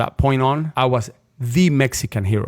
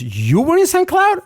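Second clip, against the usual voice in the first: pitched way up and slowed in disbelief; high, slow astonished query; amazed question; 4 dB louder than usual. Retold or imitated. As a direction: let the surprise lift it high and stretch it. Officer amazed at shared town.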